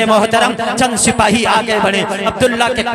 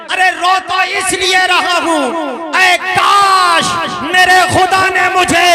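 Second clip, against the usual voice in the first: impassioned cry; 4 semitones higher than usual. Acted. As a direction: call out the longing to heaven. Preacher at full force.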